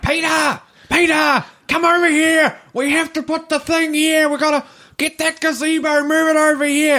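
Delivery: very high-pitched hollered orders; mock a pageant-type wife shrieking orders at her husband; bossy official's wife. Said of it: "a stereotypical view of someone".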